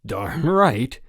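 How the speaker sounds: gleeful, unrepentant admission; grinning. A narrator's reading. Frank owning up to tripping Jack, grinning. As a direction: say it through a grin. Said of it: "grinned Frank".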